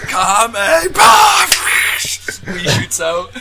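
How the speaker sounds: high, slow drawn-out shout; hammed-up ad shout. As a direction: ham up a cheesy ad. Mocking Wii commercial.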